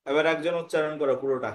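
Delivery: ordinary talk, nothing marked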